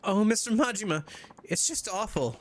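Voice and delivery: put-on high, upset girlfriend voice calling to Majima; distressed young woman. Reads game heroine's dialogue.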